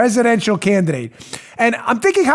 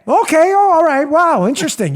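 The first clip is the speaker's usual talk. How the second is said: higher-pitched mock amazement; mock impressed; 6 semitones higher than usual. Host plays an official hearing of the dossier, mock impressed. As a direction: play impressed, pitched up, trailing down.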